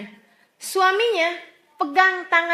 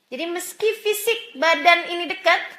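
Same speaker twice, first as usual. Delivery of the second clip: ordinary talk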